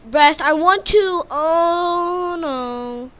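loud, high whimpering cry; loud frightened whimper; frightened whine. Real, not acted. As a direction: cry out in real fear. Game refuses sleep, monsters.

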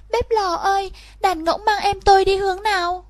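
put-on a small girl anxiously seeking her brother; girl's voice. Girl asks the stove. (giọng bé gái cao, hỏi han lo lắng)

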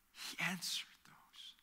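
a soft, slow whisper; whispered echo. Real, not acted. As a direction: whisper it as a quiet truth.

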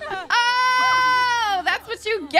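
high, drawn-out mock wail of triumph; gloating cry. Player punishing her game character, loud gloating. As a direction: crow it out, stretching the words.

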